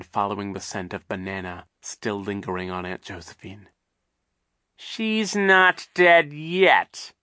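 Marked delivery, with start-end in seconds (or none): 4.8-7.1 very slow, high, menacing drawl; terrible voice. Acted. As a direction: draw it out with menace. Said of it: "said in a terrible voice".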